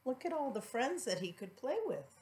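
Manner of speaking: gentle hushed comment; soft aside. Real, not acted. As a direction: quietly point out the picture. Asides to child over picture book.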